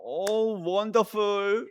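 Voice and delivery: drawn-out gushing "Oh, wonderful"; imitating André Kim. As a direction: lightly mimic his delighted exclamation. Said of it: "이렇게 하고". (앙드레 김 말투 흉내)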